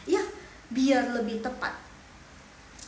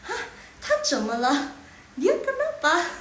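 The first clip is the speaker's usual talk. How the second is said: higher, louder mock astonishment; puzzled onlooker's question; 6 semitones higher, 5 dB louder than usual. Onlookers asking about a head-wagging student, mock puzzled. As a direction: play a baffled bystander asking about someone odd. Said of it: "Nanti mereka tanya".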